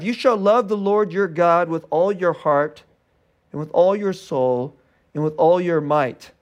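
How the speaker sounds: slow, deliberate verse reading; scripture recitation. Acted. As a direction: let each phrase land solemnly. Quotes verse five solemnly.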